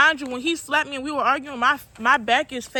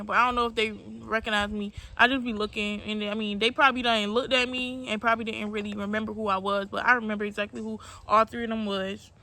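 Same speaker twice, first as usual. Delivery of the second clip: ordinary talk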